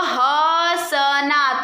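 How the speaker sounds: हसना stretched very slowly, nasal अ held; drawn-out demo word. Shown as a demonstration. Teacher to pupils, modelling the nasal vowel, slow हसना. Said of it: "ह के बाद देखो अ का pronounce".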